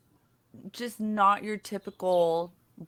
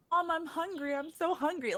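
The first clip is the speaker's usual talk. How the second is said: higher, softer, a mock pitiful child's whine; whiny despair; 8 semitones higher, 5 dB quieter, faster than usual. Mother imitates her daughter whining from timeout, mock pitiful. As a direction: play it like she's starving in a dungeon. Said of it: "like, in despair".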